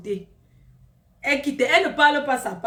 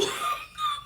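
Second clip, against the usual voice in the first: high piercing shriek of laughter; a wild, tearful laughing scream; 6 dB quieter than usual. Real, not acted. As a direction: shriek with laughter, almost crying.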